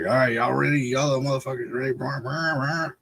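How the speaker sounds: lightly voiced uncle revving up, pitch climbing; imitated uncle's hype. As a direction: mimic the uncle's rallying call into engine noises. Uncle hyping kids before takeoff.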